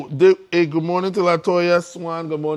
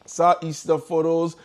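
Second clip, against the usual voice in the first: normal talking voice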